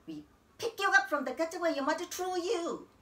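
high-pitched, cutting taunt; scornful relatives. Acted. Relatives taunting the girl they took in, scornfully. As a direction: throw it in the child's face.

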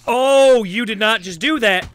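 very loud, high indignant shout; outraged yell. Real, not acted. Gamer to game attacker, outraged high yell. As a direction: explode in indignation.

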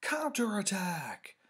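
long, high war cry; drawn-out playground battle call; opposing battle shout. A narrator's reading. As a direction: stretch out a loud rallying yell. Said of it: "came an opposing shout".

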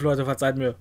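ordinary delivery

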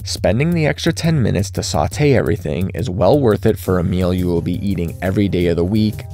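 ordinary talk, nothing marked

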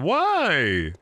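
ordinary delivery

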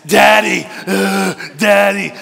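put-on loud, dragged-out growl of a fighting child; deep daughter voice. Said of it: "Her voice got real deep".

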